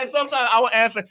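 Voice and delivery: ordinary talk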